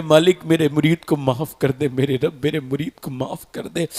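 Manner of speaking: low, soft, pleading supplication; understated retold weeping plea; imitated tearful prayer. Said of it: "रो के कहने लगे".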